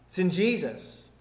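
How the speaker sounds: normal talking voice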